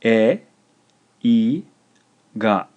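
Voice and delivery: e, i and ga split by pauses; slow, emphatic dictation with gaps; syllable by syllable. Shown as a demonstration. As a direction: dictate e, i, ga one clear syllable at a time. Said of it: "This is three syllables".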